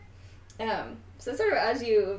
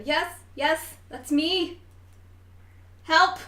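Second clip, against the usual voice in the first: put-on higher and louder, scared young voice pleading; trapped young voice; 6 semitones higher, 5 dB louder than usual. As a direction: sound small and frightened in the dark. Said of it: "you hear the voice go".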